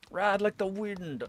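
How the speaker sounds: high, stretched-out mock rallying call; rallying cry. Streamer playfully urging on a horse, high drawn-out cry.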